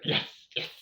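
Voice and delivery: eager breathless whisper; whispered yes. Retold or imitated. Hiker melts at milkshake offer, whispered eager yes. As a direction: whisper it like a guilty pleasure.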